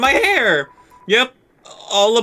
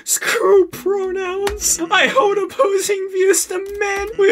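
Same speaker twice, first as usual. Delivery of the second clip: high put-on voice; 11 semitones higher than usual.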